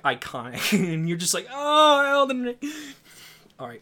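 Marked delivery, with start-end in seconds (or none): 1.5-2.5 high gushing awe; mock awe. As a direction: gush over it, hammed-up.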